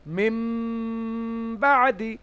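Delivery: "মিম বাদি" with a long hummed m; "মিম বাদি", m held for one long beat; iqlab with ghunnah. Shown as a demonstration. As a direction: prolong the m of "মিম" nasally before "বাদি". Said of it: "এক আলিফ গুন্না সহ একলাব করে".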